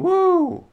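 a shrill-free high whoop; mock cheer. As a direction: toss off a mock celebratory woo. Mocking a cheering union post.

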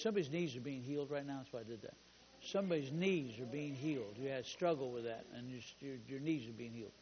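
low, very soft aside; hushed healing word. Real, not acted. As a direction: quietly declare healing over someone in the room.

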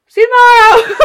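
high, loud laugh-through quote. Retold or imitated. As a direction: blurt it out cracking up. Quoting self laughing.

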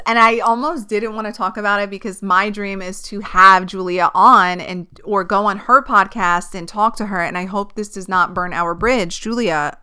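usual speaking voice

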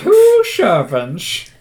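very slow, high, put-on dopey voice; slow dim servant. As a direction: drag it out like a slow-witted servant. Echoing the dopey voice.

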